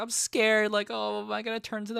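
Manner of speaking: high, frightened little voice; scared. Retold or imitated. Kurara telling Takumi she fears turning, in a high scared voice. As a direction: plead like a bitten girl afraid of turning. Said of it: "she's, like, scared".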